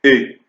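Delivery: E said open, as with a grave accent. Shown as a demonstration. Teacher showing circumflex sounds like grave accent, open E. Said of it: "se prononce de la même manière".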